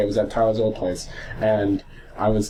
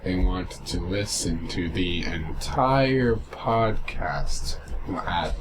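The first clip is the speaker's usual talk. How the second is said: very slow, drawn-out words, low and soft; demonstrative slow speed; 6 semitones lower, 7 dB quieter, much slower than usual. Shown as a demonstration. Showing a fast-talking friend the podcast's speed, exaggeratedly slow. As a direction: drag every word out to make a point. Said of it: "this speed".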